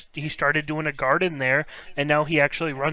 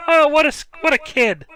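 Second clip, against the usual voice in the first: laughing squeal; 12 semitones higher, 9 dB louder than usual. Real, not acted. Laughing high at the joke.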